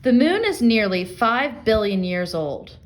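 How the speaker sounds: ordinary delivery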